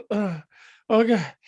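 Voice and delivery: re-enacted high-pitched fit of suppressed laughter; stifled laugh. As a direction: act out helplessly fighting back giggles. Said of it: "losing control of myself".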